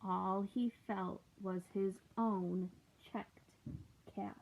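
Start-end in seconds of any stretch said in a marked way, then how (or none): none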